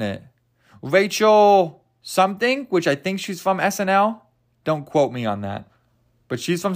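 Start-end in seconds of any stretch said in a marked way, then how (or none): none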